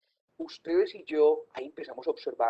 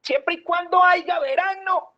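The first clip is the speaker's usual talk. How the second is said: imitating builder; 11 semitones higher, 4 dB louder than usual. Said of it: "el maestro de obra dice".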